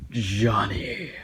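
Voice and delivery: breathy whisper up close; creepy whisper. Retold or imitated. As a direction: whisper right into his face. Teacher leaning close, bad breath.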